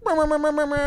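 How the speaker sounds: high, loud rapid brr-brr burst; imitated sound effect. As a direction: vocally mimic a hype man's effects board. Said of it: "he has a sound effects thing".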